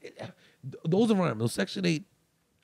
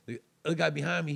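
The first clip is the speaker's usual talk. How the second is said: ordinary delivery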